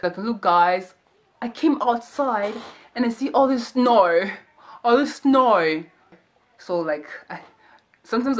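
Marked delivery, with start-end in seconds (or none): 0.2-5.8 put-on light Australian accent, snow drawn out slow. Vlogger mimics an Australian idol describing snow, light Australian accent. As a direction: imitate the Aussie K-pop idol's snow. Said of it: "the way he was saying snow".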